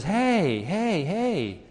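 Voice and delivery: a mock-offended man waving it off; indignant protest. Natural man objects to scripture.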